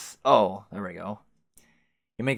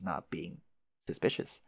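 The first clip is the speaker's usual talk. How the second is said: softer, dropping to a whisper; whispered aside; 4 semitones lower, 7 dB quieter than usual. Acted. Sneaking past dad in disguise, whispered aside.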